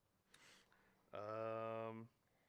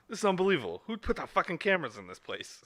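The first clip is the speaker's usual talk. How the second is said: high, loud mock outrage; irate movie quote; 8 semitones higher, 9 dB louder than usual. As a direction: act out an indignant outburst. Quoting an irate movie scene.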